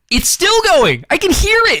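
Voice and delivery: rising outrage about the leak; outraged. Real, not acted. Outraged about leaking bathtub.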